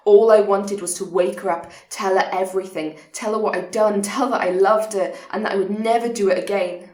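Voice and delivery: loud, urgent outpouring; pressing, heavily emphasised plea; desperate confession. Acted. Desperate wish to confess to partner.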